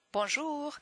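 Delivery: "Bonjour" with a high, lifted tune; high-pitched, lilting "Bonjour"; French greeting intonation. Shown as a demonstration. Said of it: "they have this intonation when they say it".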